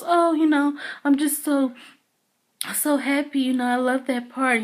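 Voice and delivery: high-pitched, smitten schoolgirl imitation; gushing schoolgirl. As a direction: gush like a little schoolgirl in awe. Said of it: "like a little schoolgirl".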